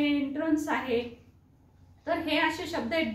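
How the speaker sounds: ordinary talk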